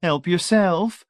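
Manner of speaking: slow, high, gushing hostess. A narrator's reading. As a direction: gush it with her mouth full.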